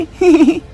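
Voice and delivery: a quick amused laugh at her husband; short laugh. Acted. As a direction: chuckle lightly, teasing him. Wife laughs at silly husband. (पति पर हल्की सी हँसी)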